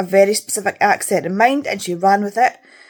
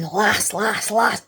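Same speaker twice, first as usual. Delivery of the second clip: mimicking written Scots, lightly put on; mock Scots; much slower than usual. Reader mocks how authors make Scots talk, mock Scottish accent. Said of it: "we didn't walk about going".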